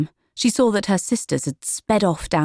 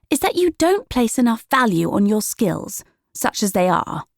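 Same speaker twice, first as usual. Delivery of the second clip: higher, condescending voice; lightly voiced sister, a touch condescending; chiding older sister; 6 semitones higher than usual. A narrator's reading. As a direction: talk down to her little sister. Juniper chiding Willow.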